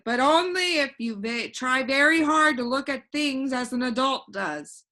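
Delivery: put-on a cheerful know-it-all boy floating in the air; voiced boy Alec. Alec advises Milo.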